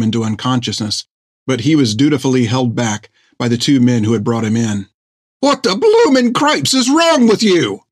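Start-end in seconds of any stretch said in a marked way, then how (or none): 5.4-7.8 a far higher, slowed-down yell. Acted. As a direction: roar at the doctor in outrage. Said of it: "he yelled at Thiggum".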